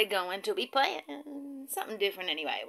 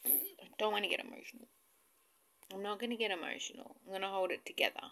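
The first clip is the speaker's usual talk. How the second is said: softer, fast, fighting emotion; holding back tears; 8 dB quieter, faster than usual. Real, not acted. Vlogger fighting tears before showing viewers, soft and fast. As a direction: rush it out quietly to keep composure. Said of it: "I'm just about to get emotional".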